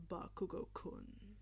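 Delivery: a hint of a menacing old villain; rumbling villain. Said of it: "All For One rumbled".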